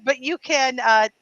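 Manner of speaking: ordinary delivery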